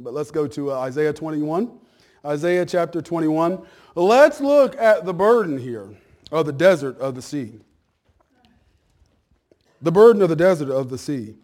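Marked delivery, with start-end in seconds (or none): 4.0-11.3 preaching. Real, not acted. Pastor announcing the Isaiah text to church, loud preaching. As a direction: proclaim the text loudly to the room.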